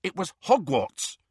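worked-up argument just short of shouting; goaded, exasperated. A narrator's reading. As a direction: press the point with fraying patience. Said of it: "goaded past endurance".

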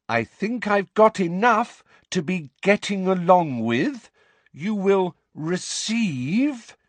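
high, loud, patronizing delivery; loud, slow voice. A narrator's reading. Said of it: "that loud, slow voice".

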